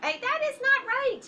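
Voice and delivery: hey, shot up high and loud; surprised playful correction. Real, not acted. Therapist catching a child's puzzle mistake, playful alarm.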